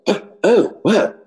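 high-pitched mock surprise; retold startled surprise. Imitating the coach who jumped back, high startled surprise. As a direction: imitate someone taken aback, pitch jumping up. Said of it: "jumped back and was like".

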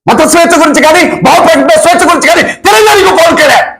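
high-pitched, indignant shouted challenge; angry accusation. Real, not acted. Angry accusing questions to a political opponent.

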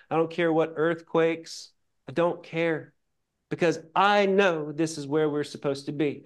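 ordinary talk, nothing marked

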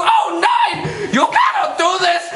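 high, hammed-up German outrage; indignant put-on German accent; outraged German man. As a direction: protest in a mock German accent. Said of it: "the German man comes on again and goes".

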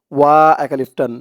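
ordinary delivery

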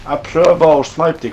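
loud strained. Real, not acted. Tense sniping in game.

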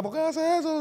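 high-pitched quoted complaint; retold girl's protest. Voices the girl friend protesting.

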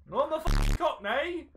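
high, insistent mock Cockney. Insisting to a doubting friend he's a Cockney, mock Cockney accent. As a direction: protest in a put-on Cockney accent. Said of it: "you ain't no cockney".

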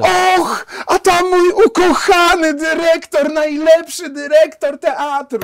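high, slow, loud theatrical gush; gushing actress. Retold or imitated. Director mimics famous actress greeting him, high slow gush. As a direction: ham up a diva fawning over her beloved director. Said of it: "Krzyczała".

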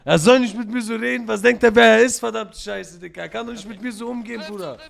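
loud, worked-up outburst; angry rant. Pretend. Gunman ranting about a disrespectful rival, loud and angry. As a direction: rant indignantly, hammering repeated phrases.

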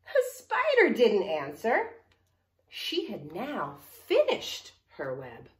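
slow, loud, deliberate refrain; story reading to children. Acted. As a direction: draw out the storybook refrain for kids. Reads story aloud.

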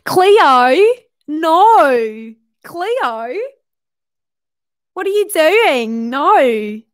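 high-pitched, swooping, hammed-up Australian; mock Australian accent. Imitating H two O show calling to Cleo, mock Australian accent. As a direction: wail it in an exaggerated Australian accent, vowels stretched. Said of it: "everyone who's saying H two O".

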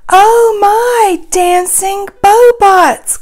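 high, loud exclamation, drawn out at the end; calling out. A narrator's reading. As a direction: call it out in delighted storybook surprise. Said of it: "calls Minnie".

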